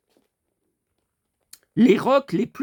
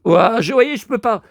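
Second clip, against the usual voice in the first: voix rauque qui lâche; voix cassée, fatiguée; voix éraillée; 6 semitones higher than usual. Real, not acted. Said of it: "j'ai la voix éraillée". (voix éraillée, incapable de chanter)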